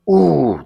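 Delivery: high annoyed ooh. Real, not acted. Man complaining about a pillow princess, high annoyed ooh. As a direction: groan at the thought.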